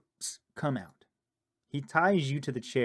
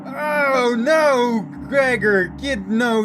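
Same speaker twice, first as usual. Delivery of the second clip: high, loud, slow cry of horror; panicked loud shout; horrified scream; 11 semitones higher, 9 dB louder, much slower than usual. Real, not acted. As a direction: shriek as if something is at the window. Horror at the whispering.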